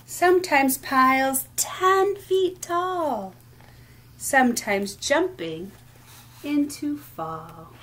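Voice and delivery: sing-song picture-book reading, lilting rhythm; reading to children. Acted. Teacher reading rhyming book aloud at storytime, lilting. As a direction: read each line like a gentle rhyme for little ones.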